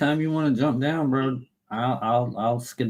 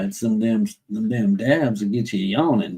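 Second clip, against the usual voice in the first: ordinary talk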